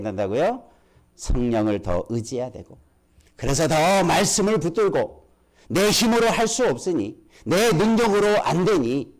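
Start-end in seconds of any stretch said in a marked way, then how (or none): 3.4-9.1 higher-pitched, urgent pulpit exhortation; fervent preaching. Acted. Preacher urging congregation to lean on the Spirit, fervent preaching. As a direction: urge the congregation on with raised pitch.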